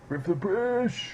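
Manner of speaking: high-pitched patriotic lout caricature; mock British nationalist. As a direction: squeal it as a British nationalist slogan. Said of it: "that's what they all look like".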